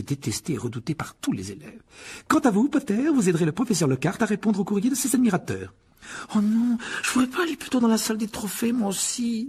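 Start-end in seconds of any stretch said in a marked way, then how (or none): 2.3-5.7 much higher, crisp stern teacher; McGonagall. A narrator's reading. McGonagall catching the boys in the hall, stern and high. 6.3-9.5 higher, pleading and despairing; desperate. A narrator's reading. Harry begging McGonagall for a different detention, desperate. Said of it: "dit Harry d'un ton désespéré".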